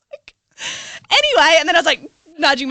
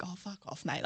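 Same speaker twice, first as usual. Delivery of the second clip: very soft mock muttering; muttered under breath; 6 semitones lower, 20 dB quieter than usual. Retelling her thought about the approaching guy, muttered softly. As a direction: mutter it to yourself. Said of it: "And I was like".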